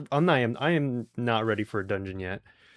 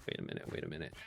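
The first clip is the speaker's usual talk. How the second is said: hushed whisper, much softer; 10 semitones lower, 16 dB quieter, much faster than usual. Real, not acted. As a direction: breathe it out in a whisper. Sudden discovery in game.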